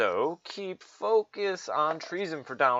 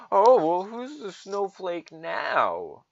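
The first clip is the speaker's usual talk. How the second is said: mocking taunt; 6 semitones higher, 5 dB louder, slower than usual. Mocking liberal retort.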